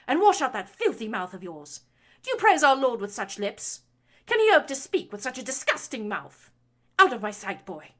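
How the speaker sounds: loud, high, rising fury; lashing out. A narrator's reading. Baroness lashing her son for lecturing her. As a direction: build the tirade to a shrieking peak.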